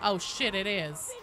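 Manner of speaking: ordinary delivery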